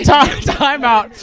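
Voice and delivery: shouting. Real, not acted. Interrupting a heated argument.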